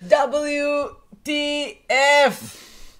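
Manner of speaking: high, loud, drawn-out letters; hammed-up, slow spelled-out outburst; mock outrage. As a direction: stretch each letter into a big exclamation.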